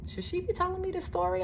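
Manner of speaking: high, quick, hushed aside; awkward inner thought. Retold or imitated. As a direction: think it to yourself, uneasy. Inner thought while driver confides.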